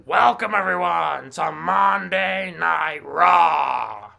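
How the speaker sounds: loud, slow, drawn-out ring announcement; hammed-up wrestling-boss shout; Vince McMahon impression. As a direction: bellow the welcome to the arena. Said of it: "That was my throat".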